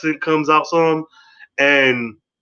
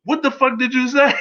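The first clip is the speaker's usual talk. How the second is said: mock furious; 12 semitones higher than usual. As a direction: go off on her in mock fury. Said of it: "She's like".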